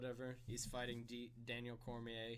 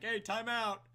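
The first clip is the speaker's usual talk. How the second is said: a sudden, much higher, louder call for a break; mock time-out call; 10 semitones higher, 11 dB louder, slower than usual. Mocking a fighter who casually asks for a time-out. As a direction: sing out the time-out like a carefree fighter. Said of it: "you can't just be like".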